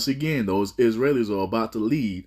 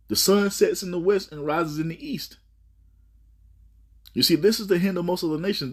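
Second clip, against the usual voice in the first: much higher, driving sermon voice; fiery preaching; 7 semitones higher than usual. Acted. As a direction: preach it up high at full force. Preaching at full force.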